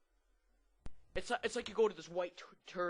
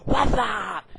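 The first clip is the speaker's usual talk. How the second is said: high, very loud imitated yell; screamed greeting back; 7 semitones higher, 20 dB louder than usual. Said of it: "And the other guy's like".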